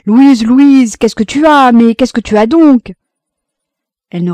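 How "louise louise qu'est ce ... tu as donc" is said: loud, high-pitched distraught pleading; desperate, raised-voice questioning; frantic. A narrator's reading. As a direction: call out to her in panic. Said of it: "Roland, éperdu, demandait".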